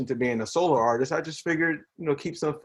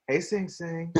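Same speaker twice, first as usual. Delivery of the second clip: ladies' voice; 3 semitones higher, 6 dB quieter, slower than usual. Retold or imitated. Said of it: "They never just say it like".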